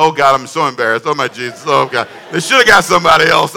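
low, self-pitying muttering to yourself; embarrassed inner voice. Acted. Fallen pastor privately panicking on the floor, embarrassed inner voice. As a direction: groan inwardly in mortified embarrassment, pitched low. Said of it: "you gotta hear what's going on".

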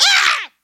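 sudden high loud shriek of frustration; inner scream. Retold or imitated. Pastor acting out his inner tempted scream. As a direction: let out a short high comic yell. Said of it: "inside I'm going".

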